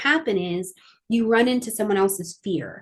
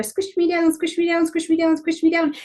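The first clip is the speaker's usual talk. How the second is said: squished voice; 5 semitones higher than usual. Acted. Astrologer voicing the squeezed feeling of the square, mock high plea. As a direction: voice pitched up, each squish me down leaned on. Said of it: "you're being squished in here".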